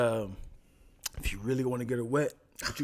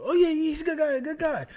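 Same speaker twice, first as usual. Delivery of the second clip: forced casual; 8 semitones higher, faster than usual. Retold or imitated. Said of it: "I'm trying to be cool".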